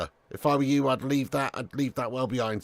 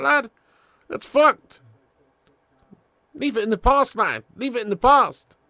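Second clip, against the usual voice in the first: louder, higher half-laughing scolding; loud telling-off; 8 semitones higher, 8 dB louder than usual. Real, not acted. Streamer scolding a chatter for his comment, loud and high. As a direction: tell him off, loud and high, half joking.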